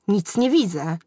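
a wizard mildly yelling that he cannot see; mild acted yell. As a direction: cry out, blinded by the sun. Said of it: "wrzasnął".

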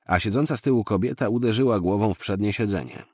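ordinary delivery, nothing marked

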